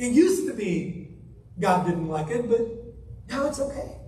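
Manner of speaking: normal talking voice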